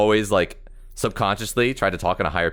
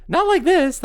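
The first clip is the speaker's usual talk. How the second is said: much higher and louder, squeezed up; forced high voice; 5 dB louder, slower than usual. Shown as a demonstration. Showing the friends his old Mickey Mouse voice. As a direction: push the voice way up like a cartoon mouse. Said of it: "forcibly talk in a higher voice".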